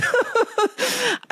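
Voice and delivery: rueful laugh through the words. Real, not acted. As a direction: laugh it off with a pang. Guest laughs at own admission.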